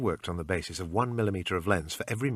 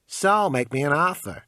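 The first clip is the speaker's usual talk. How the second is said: pawn shop salesman; 6 semitones higher, 6 dB louder than usual. Acted. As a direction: sell it like a pushy clerk. Shopkeeper haggling.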